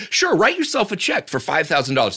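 mock salesy pitch. Mock reading of credit offer.

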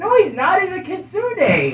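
very high squeaky voice; high character voice. Acted. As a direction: pitch way up for the character. Player voicing character, big shift.

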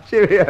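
laughs out a cheerio between chuckles; can't stop laughing on the way out; hearty laughter. Acted. Inspector leaving the flat, still laughing at his rival.

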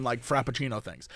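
ordinary delivery

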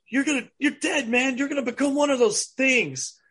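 high-pitched mock alarm, imitating a movie character; acted alarm. Character telling friend he's turning zombie, high mock alarm. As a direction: warn him in an overdramatic high voice. Acting out a character's panicked warning.

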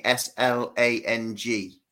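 "S L A N G" slowly, one letter at a time; spelling out. Shown as a demonstration. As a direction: spell "S L A N G" out for the listener. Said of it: "How do you spell the name".

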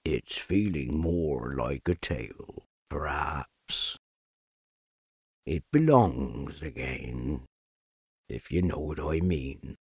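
low, slow, mournful donkey drone; deep, dragging, defeated donkey voice; gloomy Eeyore. Acted. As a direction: sigh each word out like a sad old donkey. Eeyore speaks.

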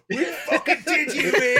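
high, loud, laughing gloat; gloating cheer. Retold or imitated. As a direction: crow over the prank like a drunk mate. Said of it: "He's going".

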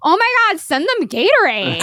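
over-the-top mock enthusiasm; mocking gushing fan. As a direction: gush like a fake fan, stretched out. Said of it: "been like".

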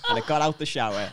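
usual speaking voice

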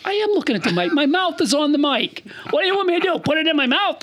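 mock-offended, defending himself; indignant protest. Real, not acted. Indignant reply to mic complaint.

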